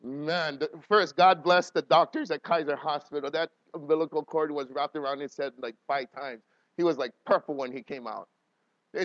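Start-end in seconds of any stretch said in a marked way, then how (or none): none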